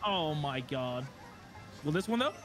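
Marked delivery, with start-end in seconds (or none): none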